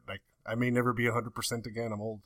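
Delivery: normal talking voice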